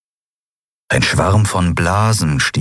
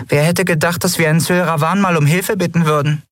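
1 hohe Figurenstimme; 11 semitones higher than usual. Acted. Nelen spricht, Figurenstimme.

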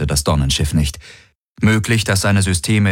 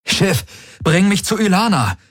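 high, desperate call to the ship; pitched-up, pressing order; urgent command; 12 semitones higher than usual. A narrator's reading. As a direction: demand help in a high voice. Desperate order during attack.

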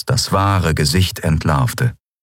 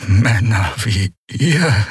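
slow, labored, forced-out words; strained gasp; 4 semitones higher, much slower than usual. Acted. Dying prisoner forces words out at his captors, labored. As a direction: squeeze each word out through pain. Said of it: "brachte er hervor".

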